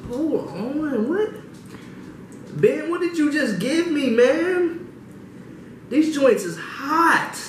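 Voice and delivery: high-pitched shocked outcry; can't believe how hot it is; shocked disbelief. Real, not acted. Shocked by the spice.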